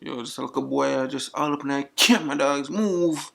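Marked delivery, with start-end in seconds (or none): none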